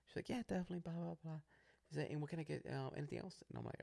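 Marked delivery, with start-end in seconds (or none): 0.3-1.4 put-on higher, softer young drive-thru girl. Young worker greeting customers at the drive-thru, high soft girl voice. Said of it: "A young girl comes up to us". 2.1-3.4 put-on higher, softer young drive-thru girl. Young worker greeting customers at the drive-thru, high soft girl voice. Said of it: "A young girl comes up to us".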